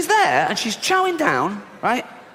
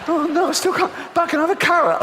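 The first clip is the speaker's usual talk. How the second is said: high frantic whine; desperate starving shopper; 11 semitones higher than usual. Retold or imitated. Starving shopper forced to eat fruit, high frantic whine. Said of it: "and they go".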